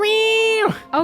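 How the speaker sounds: very high squeaky meow; meowing cat. Acted. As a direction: mew like a tiny trapped cat. Papa cat answers.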